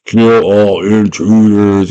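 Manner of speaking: slow, loud mechanical threat; whir, then declare it like a giant robot; huge robot. Acted. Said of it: "there's this huge-ass robot".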